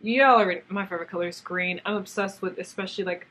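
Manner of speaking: ordinary talk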